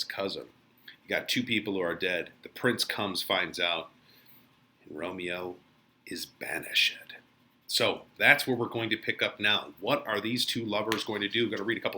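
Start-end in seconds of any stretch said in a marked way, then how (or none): 4.9-7.0 whispered, hushed "banished"; hushed storyteller whisper; dramatic whisper. Acted. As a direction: drop to a secret whisper for the doom. Dramatic retelling of Romeo's fate.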